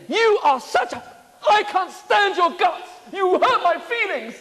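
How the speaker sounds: a joking rant at a friend who hurt you; mock accusation. Shown as a demonstration. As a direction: pretend to snap at a friend. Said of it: "I'm just joking".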